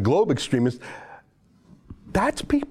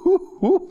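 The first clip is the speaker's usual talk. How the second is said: mock whoo. Mocking the hate he saw.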